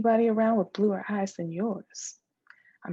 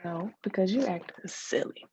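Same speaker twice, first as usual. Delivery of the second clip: usual speaking voice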